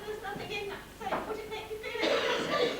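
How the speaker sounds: high-pitched, protesting householder; frightened victim act. Acted. Lady to burglar about the safe, frightened victim act. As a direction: plead innocence to an intruder.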